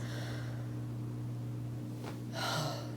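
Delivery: soft, airy sigh; hushed exhale after a sad thought. Acted. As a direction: let out a quiet breath. Sighs over absent family.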